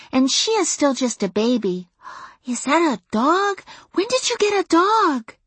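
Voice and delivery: put-on high-pitched, bossy big-sister child voice; high kid voice, chatty and know-it-all; six-year-old girl. A narrator's reading. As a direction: report importantly as a little girl. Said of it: "The next oldest girl, Sissy, who was six".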